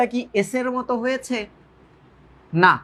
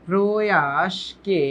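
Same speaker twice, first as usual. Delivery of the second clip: very slow, syllables drawn out; slow dictation; much slower than usual. Shown as a demonstration. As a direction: dictate it piece by piece, stretching each syllable. Dictating slowly to the class. (ধীরে ধীরে টেনে বলা)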